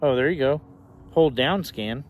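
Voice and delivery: ordinary delivery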